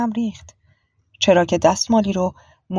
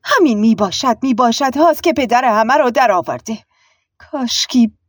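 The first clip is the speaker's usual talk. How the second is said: harsh, louder, higher aunt; 5 semitones higher, 5 dB louder than usual. A narrator's reading. Aunt cutting at the foreign doctor, harshly. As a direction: snap back roughly, mocking her word. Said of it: "عمه به خشونت گفت".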